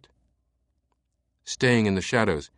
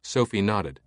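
ordinary delivery

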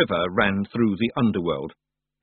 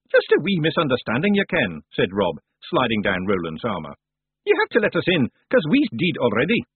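high Scots voice smoothing things over; breezy Scottish excuse-maker; Rob Anybody, wheedling Scot; 3 semitones higher than usual. Acted. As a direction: talk your way out in broad Scots.

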